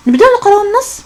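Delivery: rally the pupils to begin reading; انطلاقة حماسية; upbeat call to start. Real, not acted. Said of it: "calls class to start".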